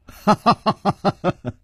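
short high laugh that falls away; amused laugh. Acted. 楚留香说完俏皮话自己笑了, short high falling laugh. Chu laughs after his quip.

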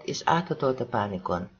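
ordinary delivery, nothing marked

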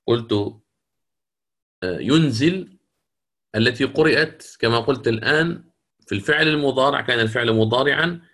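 normal talking voice

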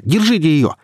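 high urgent order; agitated shouted command; shouting. A narrator's reading. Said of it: "крикнул Илья".

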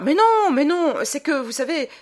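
high, loud, eager protest; eager insistence. Acted. Bondel eagerly brushes away Tancré's fear, high and loud. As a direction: wave the worry away eagerly.

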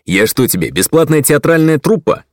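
irritated retort, rising sharply; snapping back. A narrator's reading. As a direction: snap back at him. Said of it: "Огрызнулся я".

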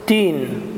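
تين with a hard unvoiced t; mock the mistaken "tin"; ta instead of dal. Shown as a demonstration. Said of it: "تين ولا دين".

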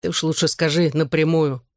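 usual speaking voice

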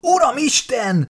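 high-pitched cry of alarm; panicked wail. A narrator's reading. Biologist wailing as creature squeezes his arm.

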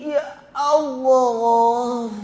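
very slow, drawn-out groaning plea; mock pained groan. Sufferer with a boil trying to stand, acted long groan. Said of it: "Coba kalau diuji sakit".

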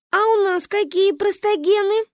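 put-on high, inquisitive little-girl voice; curious child. A narrator's reading. Daughters asking mother about their genes, curious. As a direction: ask innocently in a high child's voice.

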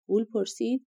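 plain reading voice

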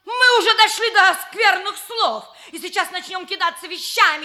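high, loud indignant retort; heated outburst. Acted. Woman warning opponents she'll start throwing things, heated outburst.

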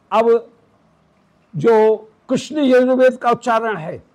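usual speaking voice

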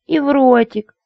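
high, squeally coquette; squealing lady. A narrator's reading. Lady to her suitor, squealing for kisses. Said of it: "Что ж так визжать-то?".

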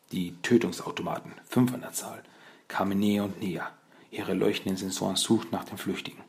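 ordinary delivery

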